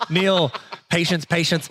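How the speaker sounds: ordinary talk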